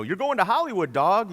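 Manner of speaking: high, punchy mock Idol congratulations; mock excited judge. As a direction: hand out the golden news, high and hyped. Imitates American Idol judges' good news.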